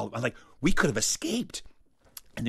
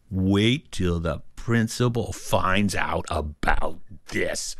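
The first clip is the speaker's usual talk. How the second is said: slow, word-by-word, low pitch; ominous, drawn-out tattletale vow; scandalized threat; 5 semitones lower, much slower than usual. Acted. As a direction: weigh every word with mock menace. Child's scandalized thought, drawn out.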